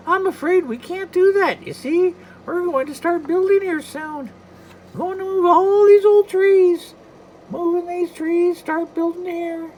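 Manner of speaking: high falsetto mouse voice; bad Mickey Mouse. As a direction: chirp it like a cartoon mouse. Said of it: "my Mickey is so bad".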